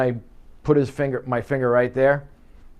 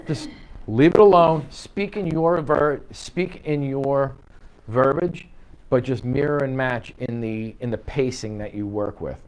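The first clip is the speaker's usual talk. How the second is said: ordinary delivery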